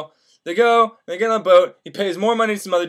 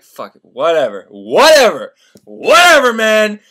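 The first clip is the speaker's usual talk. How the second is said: mounting exasperation; 8 dB louder than usual. Retold or imitated. Candide gives in to the boatman's demand, increasingly exasperated.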